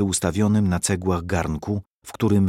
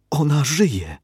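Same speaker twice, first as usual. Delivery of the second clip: joyful exclamation; 6 semitones higher, slower than usual. Acted. Nurse learns her mother lives.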